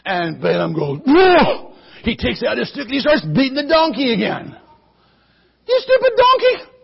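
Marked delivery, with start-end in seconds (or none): 1.0-1.7 loud high yelp of surprise; startled whoa. Acted. Rider startled by grapevine. 5.7-6.7 high, loud furious yell; scolding shout; angry yell. Acted. Rider yelling at donkey again.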